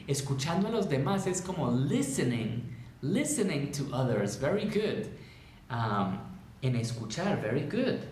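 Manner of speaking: ordinary talk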